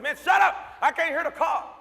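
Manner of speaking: loud, high, acted snapping back; exasperated yell. Man silencing screaming friend, exasperated yell. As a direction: yell over the panic.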